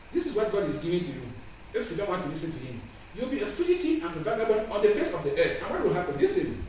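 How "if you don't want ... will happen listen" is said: ordinary talk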